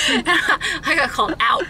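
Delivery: high, slow words through laughter; amused, drawn-out admission through giggles; real laughing. Real, not acted. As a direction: laugh helplessly while owning up. Laughing at being called out.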